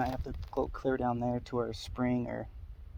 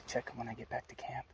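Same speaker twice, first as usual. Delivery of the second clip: quiet whisper; 8 dB quieter, faster than usual. Real, not acted. At the trail camera.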